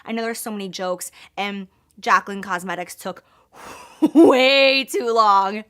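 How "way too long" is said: laughing. Real, not acted. Laughing at her own delay.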